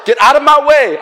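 loud, high yell acted out while running; frantic shout. Man rushing up the escalator, frantic retold shout. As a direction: shout people out of the way.

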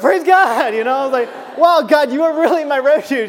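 very high, loud, laughing praise; joyful shout. Retold or imitated. Preacher relives thanking God after the win, shouting with joy.

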